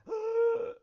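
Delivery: loud, high, sharp gasp; heart attack gasp. Acted. Bob gasping in a heart attack, loud and high.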